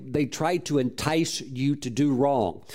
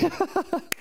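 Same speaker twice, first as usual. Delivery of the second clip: quick high laugh. Real, not acted. Laughs at the anecdote.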